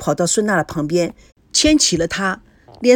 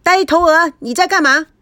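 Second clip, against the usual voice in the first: furious yell; 6 semitones higher than usual. A narrator's reading. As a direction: scream at him across the bridge. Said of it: "气得疯狂大叫".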